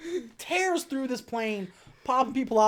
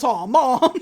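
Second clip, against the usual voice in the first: loud, higher mock-son protest; 4 semitones higher, 9 dB louder than usual. Son objects to taking his brother along, mock protest. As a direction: protest loudly at mom, half laughing.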